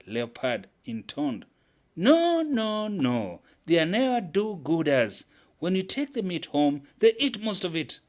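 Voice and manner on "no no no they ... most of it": high, loud protest, much higher; agitated. A narrator's reading. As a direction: cry out in alarmed disagreement. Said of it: "Squirrel was now sounding agitated".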